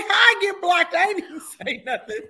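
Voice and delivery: high, laughing "How I get blocked?"; mock outrage while laughing; laughing disbelief. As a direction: squeal it through laughter. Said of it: "I'm like".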